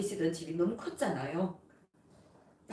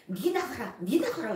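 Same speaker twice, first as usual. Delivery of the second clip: mother's shouting; 5 semitones higher, 5 dB louder than usual. Retold or imitated. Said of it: "이러는 거예요".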